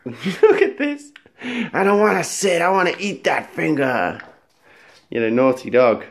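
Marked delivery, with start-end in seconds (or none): 0.1-4.2 high playful voice for the dog; dog's voice. Pretend. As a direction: speak for the dog, high and goofy. Voicing the dog's thoughts.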